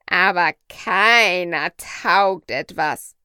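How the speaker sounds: slow, loud, deliberate parrot voice; talking parrot. Acted. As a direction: spell it out slowly for the doctor.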